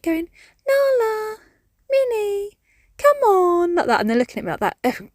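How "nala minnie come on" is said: very high sing-song coaxing of the cats; cutesy and sweet. Retold or imitated. Owner calling her cats in at night, high and cutesy. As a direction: coo the names sweetly way up high. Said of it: "being all cutesy and sweet".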